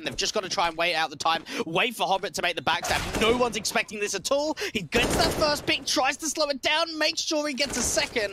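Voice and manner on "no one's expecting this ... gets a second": esports caster. Real, not acted. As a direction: shout the round's climax. Live play-by-play climax.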